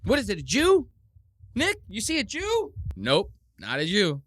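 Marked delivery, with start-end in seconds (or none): 0.1-2.7 high, loud mocking squeal of excitement; mock excited Nick. Host mocking Nick's excitement at the super chat, high squeal. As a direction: ham up his wide-eyed delight.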